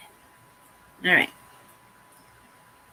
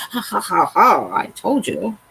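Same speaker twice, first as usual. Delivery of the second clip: imitating a husband's I-told-you-so laugh; mock gloating laugh; 4 dB louder than usual. Crafter imagines husband laughing at her mistake, mock gloating. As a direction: laugh it out mockingly, drawn out. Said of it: "the husband's laughing at me right now".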